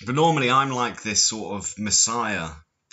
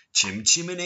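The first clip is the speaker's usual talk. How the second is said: high, badly faked Cockney chant; American doing Cockney; 8 semitones higher than usual. Parodying an American actor's musical Cockney, high mock accent. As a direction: chant it in a hammed-up fake Cockney. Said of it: "that's my American doing a Cockney accent".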